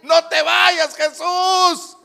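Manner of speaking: high, loud pleading shout; drawn-out cry at the top of the voice. Acted. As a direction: yell desperately for him to stay. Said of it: "Más gritó".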